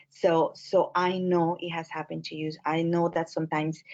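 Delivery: ordinary delivery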